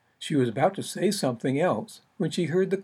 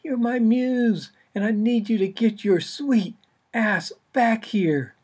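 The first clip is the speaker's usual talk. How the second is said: exasperated author; 8 semitones higher, 3 dB louder than usual. Acted. Author calling for absent muse.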